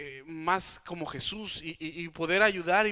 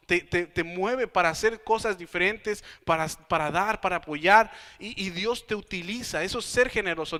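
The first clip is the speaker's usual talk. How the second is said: full-force preaching; 5 dB louder than usual. Acted. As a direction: drive the lesson home to the congregation. Preaching the point to congregation. (predicador que exhorta con fuerza a la congregación)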